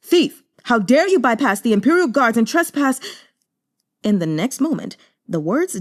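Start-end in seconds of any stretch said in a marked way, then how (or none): 0.0-3.0 much higher, louder furious shout; captain's angry cry. Acted. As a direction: bark it at the intruder, sword raised. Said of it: "he raised his sword and cried".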